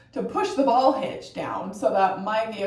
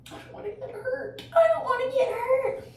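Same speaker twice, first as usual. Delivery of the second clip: scared. Real, not acted. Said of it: "frightens the shit out of me".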